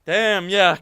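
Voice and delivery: ordinary talk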